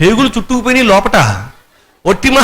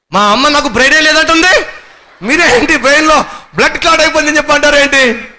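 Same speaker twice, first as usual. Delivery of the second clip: hint of indignant patient; 9 semitones higher, 3 dB louder, faster than usual. Patient quoted arguing with doctor.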